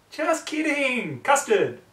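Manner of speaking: very high, slow and loud playful squeal; Pinkie joking. Acted. Pinkie revealing the prank to Twilight, high and loud. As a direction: stretch it out giddily.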